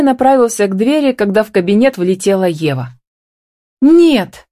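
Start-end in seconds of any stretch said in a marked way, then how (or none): 3.8-4.5 sharp, high, loud exclamation; cried out. A narrator's reading. Wife bursting into her husband's office, crying out. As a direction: cry out in protest, pitched high. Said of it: "вскрикнула она".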